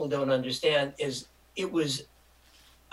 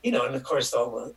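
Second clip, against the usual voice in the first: normal talking voice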